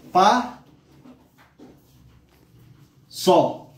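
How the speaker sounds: high PA, long pause, then SÓ; split into syllables. Shown as a demonstration. Teacher separating passo, one syllable at a time. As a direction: say "pa" and "só" as isolated syllables.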